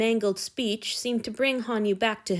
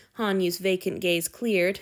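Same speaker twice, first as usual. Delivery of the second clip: usual speaking voice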